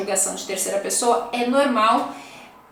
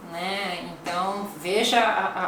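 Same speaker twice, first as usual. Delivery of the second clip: ordinary delivery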